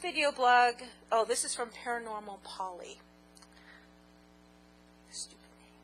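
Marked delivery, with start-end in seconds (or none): 5.1-5.7 whispered aside. Real, not acted. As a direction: whisper it under breath. Aside about name.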